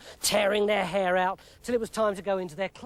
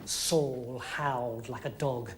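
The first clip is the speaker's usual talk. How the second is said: ordinary delivery, nothing marked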